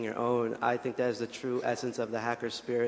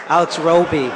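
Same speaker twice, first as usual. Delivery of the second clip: loud, higher-pitched correction of the name; emphatic correction; 5 semitones higher, 14 dB louder than usual. Real, not acted. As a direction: call the name out so it gets heard. Correcting the misheard name loudly.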